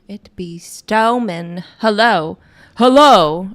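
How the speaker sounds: loud, drawn-out projection; loud mic test. Real, not acted. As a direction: call out loudly to test the mic. Projecting loudly to test microphone.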